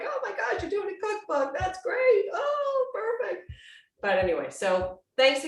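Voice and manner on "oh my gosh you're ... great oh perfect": excited family. Retold or imitated. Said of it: "My family's like".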